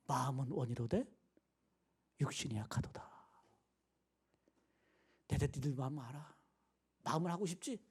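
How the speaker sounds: low, soft, acted compassion; warm, tender understanding. Jesus to his tired, sleeping disciples, tender understanding. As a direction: reassure gently, mostly hushed. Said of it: "예수님 얼마나 따뜻하세요".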